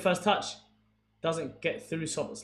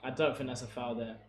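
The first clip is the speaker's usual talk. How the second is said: normal talking voice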